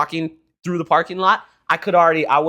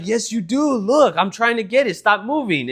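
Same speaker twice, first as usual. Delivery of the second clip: higher-pitched teasing insistence; imitated insistence; 6 semitones higher than usual. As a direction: pester like a trolling dad. Dad insisting while pinching.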